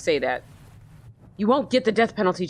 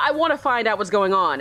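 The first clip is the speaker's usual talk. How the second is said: ordinary talk, nothing marked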